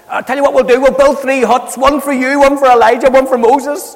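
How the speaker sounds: high, loud babbling Peter; blurting rubbish. Retold or imitated. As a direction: blurt it out, flustered and eager. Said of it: "he's blurbing out just rubbish".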